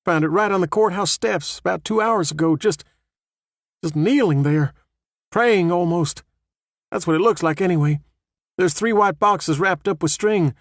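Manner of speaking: a hint of a nervous sheriff; lightly voiced rattled sheriff. A narrator's reading. Sheriff reports the body.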